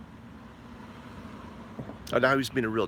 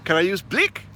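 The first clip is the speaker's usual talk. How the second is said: smart-aleck ask; 5 semitones higher, 3 dB louder than usual. Retold or imitated. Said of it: "the smart ass thing that I said".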